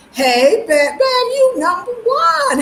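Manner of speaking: high, drawn-out joyful shout-out; excited greeting. Real, not acted. Livestream host hailing a viewer, excited. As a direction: call out to a viewer with delight.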